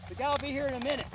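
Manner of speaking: a hint of a breezy clerk reassuring him; clerk's reassurance. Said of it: "He goes".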